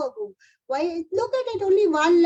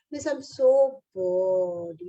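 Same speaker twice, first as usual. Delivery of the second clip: slow, drawn-out whine of a bored pupil; whiny bored child; 6 semitones lower, much slower than usual. Retold or imitated. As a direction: stretch it out like a bored kid. Bored child voiced in class.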